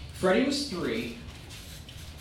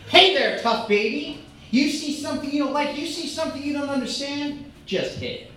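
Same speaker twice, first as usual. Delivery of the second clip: mock tough-guy pep talk; 8 semitones higher, 3 dB louder than usual. Addressing a tough baby.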